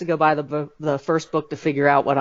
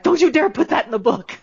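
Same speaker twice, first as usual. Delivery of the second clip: higher, laughing mock warning; warning cousins; 10 semitones higher than usual. Cousins warning the author not to write it, laughing mock threat. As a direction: scold her with a laugh in it. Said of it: "And they're like".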